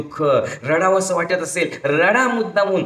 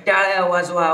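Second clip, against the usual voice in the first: usual speaking voice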